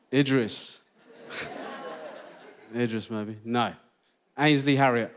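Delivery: ordinary talk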